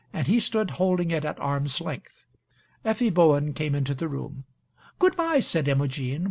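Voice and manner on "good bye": high-pitched, formal social farewell; society manner. A narrator's reading. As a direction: switch instantly to a polite society goodbye, pitched high. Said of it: "instant assumption of society manner".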